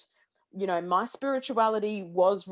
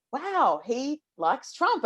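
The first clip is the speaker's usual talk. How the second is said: surprised; 6 semitones higher, much slower than usual. Retold or imitated. Quoting her own surprised reaction.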